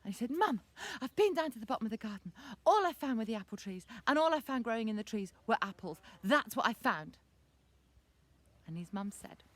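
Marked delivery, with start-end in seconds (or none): 0.3-7.2 put-on panting child, rushed and loud; out of breath. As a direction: gasp out the news after running home. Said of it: "he was out of breath".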